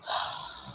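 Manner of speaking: breathy, quiet sigh; soft despairing sigh. Real, not acted. She sighs over the spider loose in her room. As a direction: let out a defeated sigh. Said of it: "Flipping hell".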